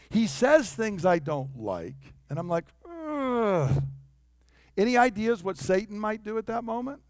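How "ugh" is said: long "ugh", high then falling; disgusted groan. Acted. Follower hearing what he dislikes, disgusted groan. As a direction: groan high in distaste and let it drop. Reacting to things he doesn't like.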